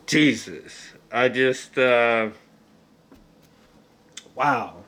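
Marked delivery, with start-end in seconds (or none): none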